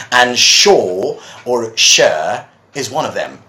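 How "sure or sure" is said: "sure" first rhyming with shore, then "sure" rhyming with fur; two pronunciations. Shown as a demonstration. Teacher listing two accepted pronunciations, contrasted demo. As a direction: slowly contrast both vowels of "sure". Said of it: "three pronunciations".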